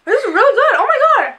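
high and loud delight; excited. Real, not acted. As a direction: rave about the taste. Loves the candy.

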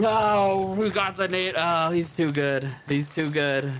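high, dismayed cry; frustrated outburst. Real, not acted. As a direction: wail in disbelief at losing. Dismay after dying.

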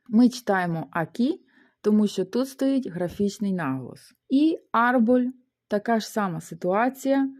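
usual speaking voice

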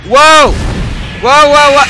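loud, high cries of alarm; startled yell. Real, not acted. Player caught off guard in a game, startled yelling. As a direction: yelp out in sudden alarm.

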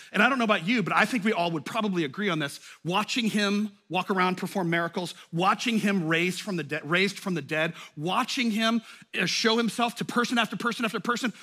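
ordinary delivery

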